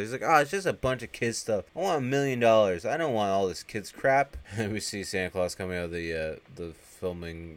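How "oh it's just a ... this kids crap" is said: louder, raised, mildly petulant whine; imitated upset complaint. Harmony upset at finding only toys, petulant. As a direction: grumble like a disappointed kid. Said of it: "she's getting upset".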